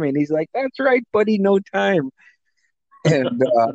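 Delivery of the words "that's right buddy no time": high-pitched imitation of a buddy; joking agreement. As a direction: agree playfully in a much higher voice. Friend's joking reply imitated.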